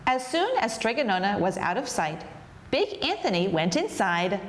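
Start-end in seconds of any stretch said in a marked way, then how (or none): none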